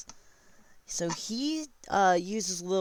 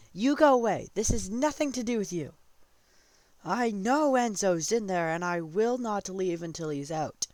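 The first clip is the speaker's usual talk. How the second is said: loud, raised-pitch royal order; commanding; 5 semitones higher, 5 dB louder than usual. Retold or imitated. King sends off someone in the way, loud command. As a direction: order an interferer away forcefully.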